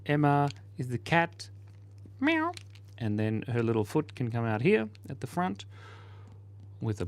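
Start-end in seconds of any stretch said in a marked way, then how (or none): 2.2-2.5 high squeaky meow; playful kitten mew; cat meow. Pretend. Voicing Emma the cat.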